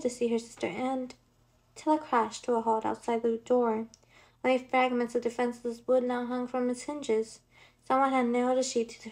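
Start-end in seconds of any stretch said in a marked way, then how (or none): none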